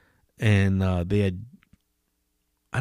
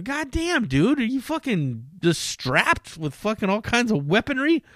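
mock amazed; 10 semitones higher, 4 dB quieter than usual. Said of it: "I go".

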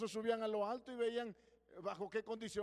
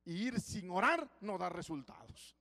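orar stressed, said slowly; slow down and press on orar; slow, emphatic maxim; 6 dB louder than usual. Shown as a demonstration. Preacher completing his maxim, slow and emphatic. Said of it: "Yo tengo una filosofía".

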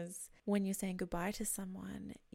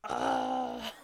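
cringe groan; 7 dB louder than usual. Real, not acted. Reacting to scratching skin sounds.

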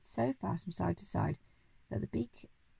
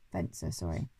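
usual speaking voice

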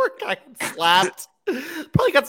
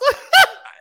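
loud high laugh; cracking up; burst of laughter; 7 dB louder than usual. Real, not acted. Laughing at the story.

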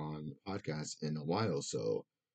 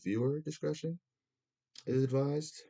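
higher mock TV announcer warning; mock broadcast disclaimer, pitched up; 9 semitones higher than usual. Shown as a demonstration. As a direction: read it like a TV content warning. Warning before smoking on stream.

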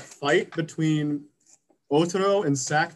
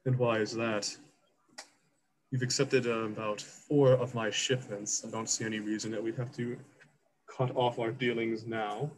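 Nikushimi, calm and soft; 7 dB quieter than usual. Acted. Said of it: "And Nikushimi says".

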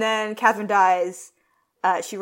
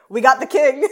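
louder, mock-proud announcement; 6 semitones higher, 10 dB louder than usual. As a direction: blurt it out loudly like a herald. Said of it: "Charles Brandon's like".

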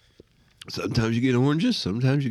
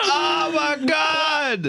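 high, strained cry of pain; crying out from the burning heat; 14 semitones higher, much slower than usual. Real, not acted. Reacting to a very hot bean.

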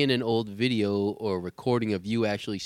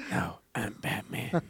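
gravelly Batman; 5 dB quieter, slower than usual. Retold or imitated.

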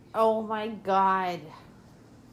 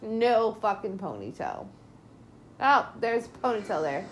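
ordinary talk

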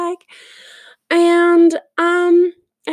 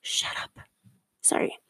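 whispered aside; 11 dB quieter than usual. Real, not acted.

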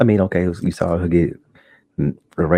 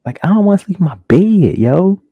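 higher and louder, spooked; scared exclaim; 9 semitones higher, 5 dB louder than usual. Real, not acted. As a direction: blurt out your fright at bedtime. Frightened viewer exclaiming.

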